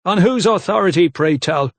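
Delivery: sneering disbelief; mocking. A narrator's reading. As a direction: belittle the upstart. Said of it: "he pointed mockingly".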